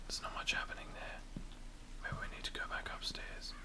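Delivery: very soft, voiceless whisper. Real, not acted. Gamer creeping through a spooky house, whispering. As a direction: whisper warily, keep the sound down. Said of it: "Why am I whispering so much".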